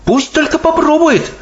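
put-on high, defiant girl voice; exclaiming Sophie. A narrator's reading. Sophie dares the giant to try, exclaiming defiantly. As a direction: throw out a dare in a high voice. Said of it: "воскликнула Софи".

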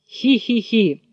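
high mock giggle, "hee-hee-hee"; sly acted fox titter; fox's giggle.

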